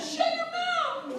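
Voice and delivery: very high, drawn-out mock tantrum; upset victim's retort. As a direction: squeal it like someone losing their cool. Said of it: "And if you get upset".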